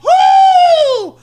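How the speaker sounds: high, loud cheering whoop; elated whoop. Retold or imitated. Proud dad cheering his daughter's assist, whooping. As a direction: cheer the basket at full volume. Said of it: "I was like".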